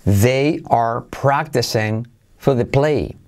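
slow, careful "They are practicing for the play"; slow model sentence. Shown as a demonstration. Teacher modelling a sentence slowly for students. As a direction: spell it out slowly for the class. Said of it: "teacher models the sentence slowly".

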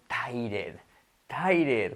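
"tired" slowly as "TAI-red"; Spanish mispronunciation. Shown as a demonstration. Teacher presenting the classic error, Spanish mispronunciation. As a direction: sound the E and R in "tired". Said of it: "es el clásico".

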